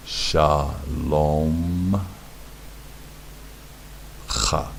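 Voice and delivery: "Shalom" drawn out, long pause, then a separate "cha"; syllable by syllable. Shown as a demonstration. Teacher breaks down a Hebrew word for learners, syllable by syllable. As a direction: stretch "Shalom", then set "cha" apart with a throaty kh. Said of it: "stopping the syllable here".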